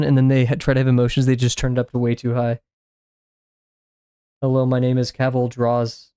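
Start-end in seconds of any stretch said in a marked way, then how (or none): 4.4-6.1 even, unvarying synthetic delivery; monotone AI voice. Shown as a demonstration. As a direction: mimic a toneless AI clone. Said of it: "extremely monotone".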